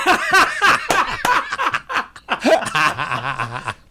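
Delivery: loud squealing laugh; high laughter. Real, not acted. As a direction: crack up loudly. Laughing at own accent.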